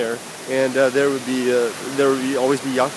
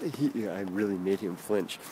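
usual speaking voice

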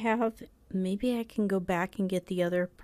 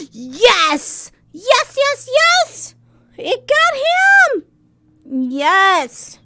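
high, loud triumphant cheering; victory cheer; 12 dB louder, much slower than usual. Real, not acted. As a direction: cheer like you just won. Celebrating a kill.

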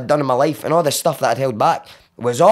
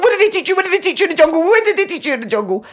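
high-pitched repeated demand; retold screaming; faster than usual. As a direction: squeal it over and over, frantically. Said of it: "I'm screaming it at him".

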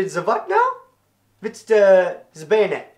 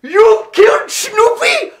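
put-on very high, loud, incredulous German-accented cry; hammed-up amazement; astonished shout; 14 semitones higher, 10 dB louder than usual. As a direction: shout the question in overjoyed disbelief. Kaiser asks again, overjoyed.

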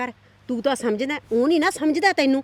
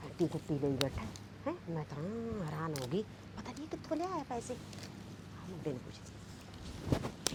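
muttered aside; 6 semitones lower, 18 dB quieter than usual. Acted. Aunt muttering aside to herself.

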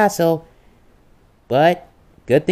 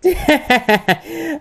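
high, impish giggle; a gleeful little laugh; mischievous giggle; 13 semitones higher than usual. Acted. Caught messing with Mario.

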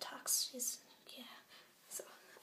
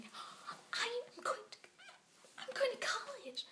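high, drawn-out squeal of excitement; giddy disbelief; 11 semitones higher, much slower than usual. Real, not acted. Orphan realizing she's going to college, high giddy squeal. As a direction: let the joy rise into a squeal.

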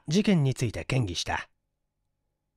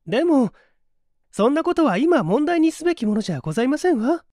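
higher, ladylike protest; young lady interjecting; 13 semitones higher, 5 dB louder than usual. Acted. Daughter breaking into her father's talk, higher lady's voice. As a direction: cut in with a higher, polite young woman's voice. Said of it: "と、令嬢が口を出した".

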